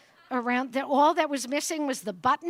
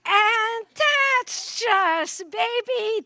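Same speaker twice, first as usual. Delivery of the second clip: drawn out, much louder and higher; triumphant relish; 10 semitones higher, 7 dB louder, much slower than usual. Real, not acted. Speaker relishing the comeback, loud slow triumphant drawl. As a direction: savor the win, stretching it out. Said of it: "triumphant emphasis".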